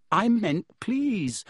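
plain reading voice

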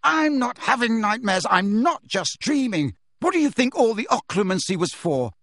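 high, impassioned argument; heated, insistent outburst; angry tirade. Acted. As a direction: rant in exasperation, driving the point home. Harry's angry tirade at friends.

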